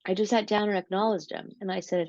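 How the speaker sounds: usual speaking voice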